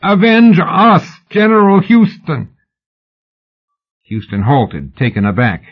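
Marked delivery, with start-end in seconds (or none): 0.0-2.5 slow, high-pitched public plea; high, stretched-out theatrical appeal; second, steadier cry. A narrator's reading. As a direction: draw out a high call to the general. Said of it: "She tried again".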